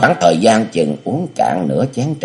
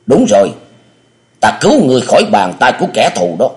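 put-on much higher, louder cunning old master; scheming elder; 6 semitones higher, 4 dB louder, faster than usual. Địa Linh feeds the amnesiac hero a false name, high and sly. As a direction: coax the name into him, high and insistent.

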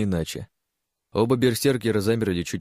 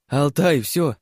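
excited; 6 semitones higher, 5 dB louder, much slower than usual. A narrator's reading. Girl excited after victory.

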